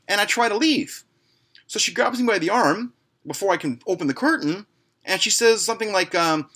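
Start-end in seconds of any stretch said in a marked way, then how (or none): none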